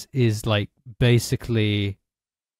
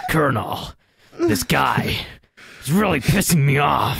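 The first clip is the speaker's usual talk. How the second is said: Solid Snake voice; 5 semitones higher than usual. Retold or imitated. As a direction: yell it like an angry soldier on the radio. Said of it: "It sounds like Solid Snake".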